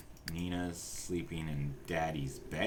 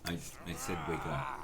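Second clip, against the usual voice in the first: stern repeat; 3 semitones lower than usual. Acted. Repeating the order to Sim.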